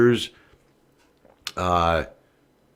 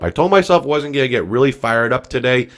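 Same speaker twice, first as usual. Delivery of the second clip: usual speaking voice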